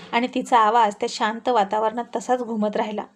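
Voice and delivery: usual speaking voice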